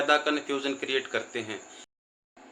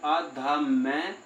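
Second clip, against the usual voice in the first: slow demo; much slower than usual. Shown as a demonstration. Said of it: "जब हम नासिका से उच्चारण करते हैं".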